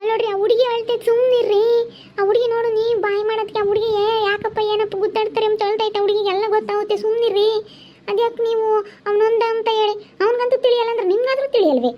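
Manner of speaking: high, urgent plea to stop the fight; desperate pleading. Real, not acted. Wife pleading, child is crying.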